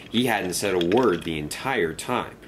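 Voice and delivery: ordinary delivery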